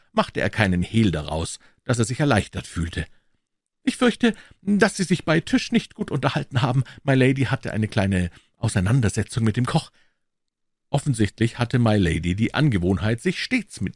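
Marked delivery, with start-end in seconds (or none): 3.8-9.9 high-voiced, apologetic lord; nervöser Lord. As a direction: start high and uneasy, like a nervous host. (hohe Stimme des nervösen Lords)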